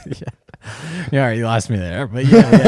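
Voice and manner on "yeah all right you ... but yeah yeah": loud, high laughing reply. Real, not acted. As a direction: laugh through the words. Laughing at the ass sweat joke.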